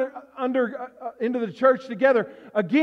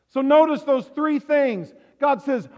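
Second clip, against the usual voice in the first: ordinary delivery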